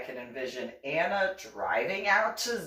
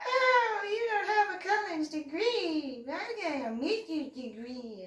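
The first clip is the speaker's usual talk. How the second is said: high, fading, snooty mock brag; a lofty high parody of bragging; mocking Anna; 10 semitones higher than usual. Anna belittling the brother for having no degree, high snooty parody. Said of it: "Anna went on and on and on".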